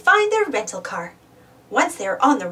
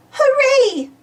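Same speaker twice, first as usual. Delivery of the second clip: high, louder cheer; happy cheer; 11 semitones higher, 5 dB louder than usual. A narrator's reading. Peppa hears it rained, high happy cheer. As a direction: cheer like a delighted child. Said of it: "cries Peppa".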